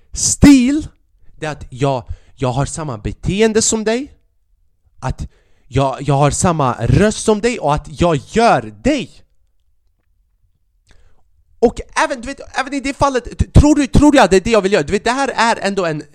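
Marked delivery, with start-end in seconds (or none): 0.1-0.9 "Stil" jumps much higher and louder, rising; stressed "Stil". Real, not acted. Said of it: "emphatic definition start". 11.6-16.0 exasperated disbelief, pitched up; indignant rant. Real, not acted.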